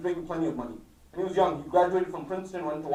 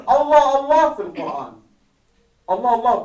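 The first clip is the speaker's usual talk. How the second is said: "Allah, Allah" loud and raised, with weight on both words; emphatic appeal; 4 semitones higher, 9 dB louder than usual. Retold or imitated. Imam to his children in his final moments, emphatic "Allah, Allah". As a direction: press hard on "Allah, Allah", drawing out the name. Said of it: "he's emphasizing".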